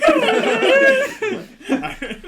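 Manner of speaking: loud laugh. Real, not acted. Player cracks up at joke.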